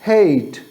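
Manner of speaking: हेट slowly, with a stretched "ay"; long-vowel example हेट; long A sound. Shown as a demonstration. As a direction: draw out the vowel of हेट as "heyyt". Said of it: "तो sound लंबी हो जाती है".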